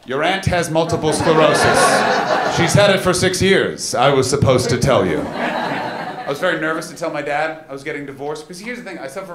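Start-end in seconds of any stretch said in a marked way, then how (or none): 0.1-2.6 loud, slow, laughing scold; loud deliberate telling off; mock scolding dad. Dad at supper loudly repeating the aunt's words back to his son. 2.6-5.2 loud teasing repeat; mock loud dad. Dad at supper echoing the aunt, loud and laughing. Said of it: "and went".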